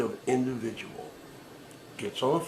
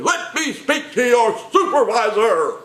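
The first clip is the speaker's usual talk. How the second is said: much higher, louder, slow outraged shout; mock furious demand; 10 semitones higher, 7 dB louder, slower than usual. Caller yelling at the agent for a supervisor, hammed-up shout. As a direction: bellow it like an outraged Karen.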